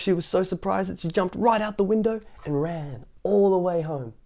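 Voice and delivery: ordinary delivery, nothing marked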